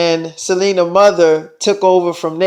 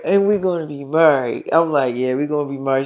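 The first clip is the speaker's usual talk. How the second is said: intimate murmur; 5 semitones lower, 6 dB quieter than usual. Acted. Couple talk intimately after guests leave.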